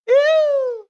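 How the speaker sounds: very high, loud shriek; piercing scream. Acted. Alfredo in the dark wood, screaming in terror. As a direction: let out a piercing horrified scream. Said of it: "listen how he's screaming".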